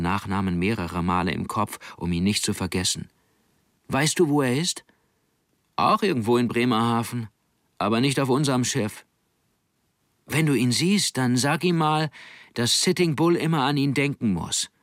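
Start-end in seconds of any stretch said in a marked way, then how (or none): 3.9-14.7 höher gesprochener eifriger Junge; Kinderstimme Nanning. Acted. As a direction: ein Junge, der aufgeregt nachfragt. Kind Nanning fragt und ruft. (Nanning ruft dem Onkel eifrig nach, leicht höher)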